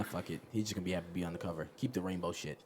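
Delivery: low, soft, offhand brush-off; dismissive boss. Acted. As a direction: shrug it off as a bored boss. Acting out boss shrugging it off.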